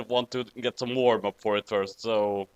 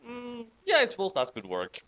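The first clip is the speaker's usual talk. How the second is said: ordinary delivery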